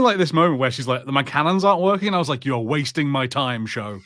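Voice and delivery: normal talking voice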